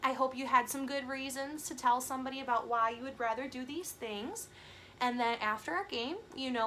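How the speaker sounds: ordinary delivery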